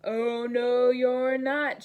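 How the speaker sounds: loud gruff troll refusal; troll shouting. Acted. Troll shouts down the middle goat, loud. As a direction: bark it at the goat. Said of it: "shouted the troll".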